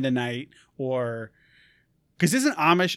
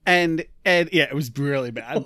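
ordinary delivery

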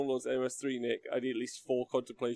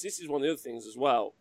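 normal talking voice

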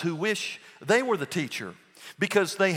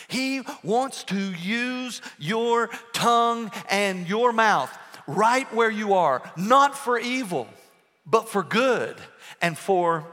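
louder, slow, rising preacher's call; preaching at full force; 5 semitones higher, 5 dB louder, slower than usual. Acted. Pastor urging the church to use their tongues for good, preaching at full force. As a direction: drive it home from the pulpit.

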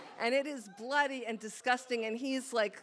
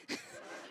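a short breathy laugh; soft laugh; 11 dB quieter than usual. Real, not acted. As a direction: laugh quietly under the breath. Laughs after erotic confession.

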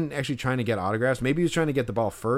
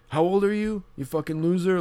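higher, sneering mock insult; imagined mocking jab; 8 semitones higher than usual. Fan imagining the pitcher shaming him, sneering. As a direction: jeer like a bully shaming a fan. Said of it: "publicly shamed me".

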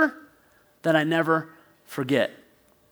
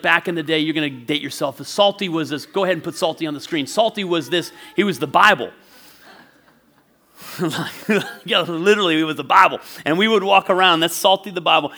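ordinary talk, nothing marked

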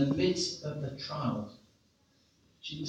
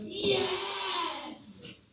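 long, high whooped yes; exuberant yell. Retold or imitated. Friend whooping as they catch the wind, exuberant yell. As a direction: stretch it out high, thrilled. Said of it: "Andy's there going".

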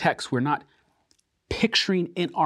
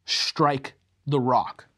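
solemn divine command; much slower than usual. Acted. God's command to Moses voiced.

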